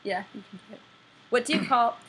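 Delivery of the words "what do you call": put-on mixed-up English accent. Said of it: "three different accents in one".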